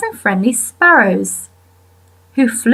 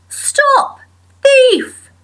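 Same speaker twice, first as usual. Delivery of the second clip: high-pitched cry of alarm after a thief; calling out. A narrator's reading. Mister McGregor chasing Peter with a rake, calling out high. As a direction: call it out high, as if chasing someone. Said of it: "waving a rake and calling out".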